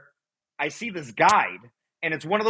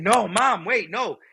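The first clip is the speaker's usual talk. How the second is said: high, mildly raised plea; retold scream; 7 semitones higher, slower than usual. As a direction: imitate calling desperately after Mom. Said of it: "I'm screaming".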